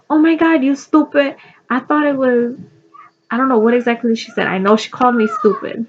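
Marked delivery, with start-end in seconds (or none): 0.1-2.6 high-pitched imitation of a Puerto Rican grandma; exclaiming in imitated Puerto Rican accent; grandma's Puerto Rican accent. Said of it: "the accent she had".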